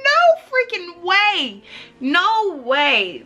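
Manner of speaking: slow, high, loud no freaking way; stunned disbelief. Retold or imitated. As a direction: gasp it out in shock. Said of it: "I was like".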